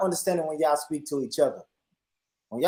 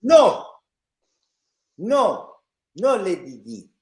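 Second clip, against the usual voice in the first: put-on Nigerian accent; 6 semitones higher, 10 dB louder than usual. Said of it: "do a Nigerian accent".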